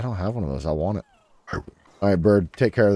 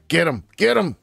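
much higher, punchy cheer; excited cheer; 8 semitones higher than usual. Real, not acted. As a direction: egg your pal on. Cheering pal on.

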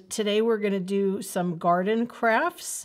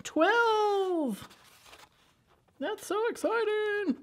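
much higher, gushing with delight; excited; 13 semitones higher, slower than usual. Acted. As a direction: thrill the kids with it. Repeats twelve excitedly.

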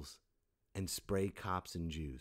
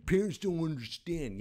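put-on whiny teen; 8 semitones higher, 5 dB louder than usual. Mocking young generation's slogan.

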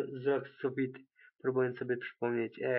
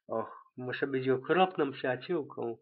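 plain reading voice

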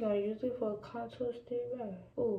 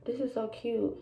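usual speaking voice

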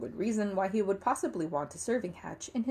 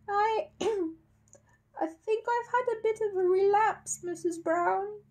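very high, slow, feeble invalid; weak voice; much slower than usual. A narrator's reading. Paddington from under the sheet to Mrs Brown, weakly. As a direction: clear the throat, then falter weakly and high. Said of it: "said a weak voice".

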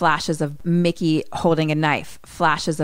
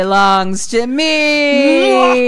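slow, loud devilish proclamation; menacing villain; 6 dB louder, much slower than usual. Retold or imitated. Robbie D claims Johnny's soul, slow villain menace. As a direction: draw it out and swell to the end.

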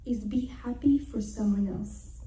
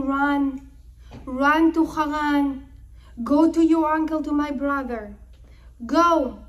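raised, emphatic urging; urgent command; 2 semitones higher, 6 dB louder than usual. Retold or imitated. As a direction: send them off with loud, urgent orders. Mother urges son to flee.

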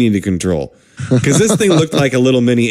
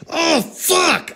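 retold yell; 4 dB quieter than usual. Patient during the procedure, mock pained yell. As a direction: cry out high without the volume. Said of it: "I mean, I'm yelling".

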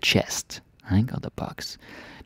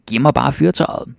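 demanding; 5 semitones higher, 6 dB louder than usual. Acted. Witch demands the tinderbox.

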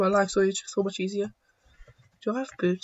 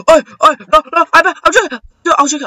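loud, high, stuttering panic; panicked yelling; 8 semitones higher, 13 dB louder, faster than usual. Real, not acted. Player under attack in the game, panicked yelling. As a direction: yelp and stumble over the words.

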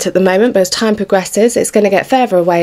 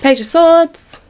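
delighted card call; 9 semitones higher, slower than usual. Real, not acted. Drawing a tarot card.

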